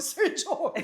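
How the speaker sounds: calling out, relieved. Retold or imitated. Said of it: "Sabi ko".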